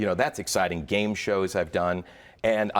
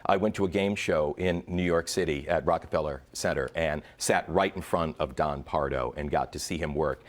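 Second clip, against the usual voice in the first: usual speaking voice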